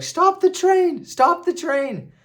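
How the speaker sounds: high, slow, frantic shouting; panicked yell. Retold or imitated. Workers panic, yelling for the train to stop. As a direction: yell it as if the train is leaving with you. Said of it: "They're like".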